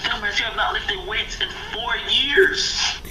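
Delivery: high-pitched scolding retort; mock exasperated. Acted retort to the teasing.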